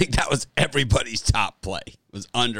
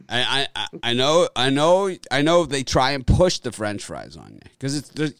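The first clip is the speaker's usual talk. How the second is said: usual speaking voice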